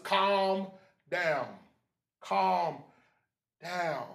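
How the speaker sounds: each word alone, with pauses; measured calming. Shown as a demonstration. Preacher settling the congregation after exhorting them, measured and calming. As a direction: let each word settle before the next. Same words, slowed to model calm.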